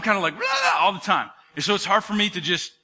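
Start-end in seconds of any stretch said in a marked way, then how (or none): 0.3-0.8 restless blabber. Acted. Pastor showing he can never be still, restless blabber. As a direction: blurt a hyper burst of babble. Imitating own restless chatter.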